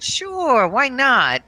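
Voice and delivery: high, mock breezy agreement; sarcastic sure. Mom mockingly agreeing to her daughter's cheeseburger idea, high and sarcastic. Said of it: "I said".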